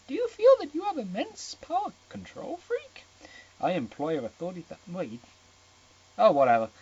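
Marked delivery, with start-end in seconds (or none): 0.1-3.0 put-on girly voice. High girl voice.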